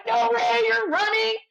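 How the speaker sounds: high, loud thrilled disbelief; excited disbelief. Retold or imitated. Crew learning the runner is back, loud high excitement. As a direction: shriek it with delighted shock.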